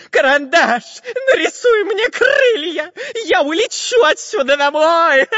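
high, loud, hysterical begging; wail, ending in a cackling sob; Dyrka frantic. A narrator's reading. Spy begs the artist for wings, hysterical. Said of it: "заметался по ветке шпион Дырка".

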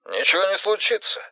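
high, soft radio reassurance; voice over receiver. A narrator's reading. Alexander answers his pleading crew by radio, soft and reassuring. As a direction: reassure them softly through the receiver. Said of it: "Усиленный приёмником голос Александра".

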